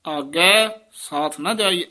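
slow, stretched ਆਗੈ, then a short gap; yamak halt on ਆਗੈ. Shown as a demonstration. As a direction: hold ਆਗੈ before going on. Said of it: "ਆਗੈ ਪਦ ਤੇ ਯਮਕ ਹੈ".

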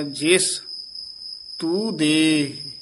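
"ਜਿਸ", clear break, slow falling "ਤੂੰ ਦੇਹ"; halt on ਜਿਸ. Shown as a demonstration. Teacher showing the halt, slow split phrase. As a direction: stop after "ਜਿਸ", stretch "ਤੂੰ ਦੇਹ". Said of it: "ਜਿਸ ਤੇ ਯਮਕ ਲਾ ਦੇਣੀ ਹੈ".

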